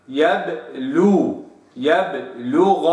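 يبلغ slow, ب bounced neutrally; qalqala on baa. Shown as a demonstration. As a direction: stretch يبلغ with a clean ب echo. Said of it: "هكذا".

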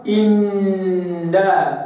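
slow إنا, hum first, then a light نا; ghunna then na. Shown as a demonstration. Teacher reading the doubled noon in two parts. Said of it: "ثم بعد ذلك تقول نا".

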